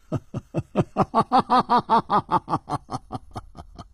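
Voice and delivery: long, high staged laugh; hearty laugh. A narrator's reading. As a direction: roll out a big ha-ha-ha that tails away. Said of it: "张啸林大笑". (仰头大笑)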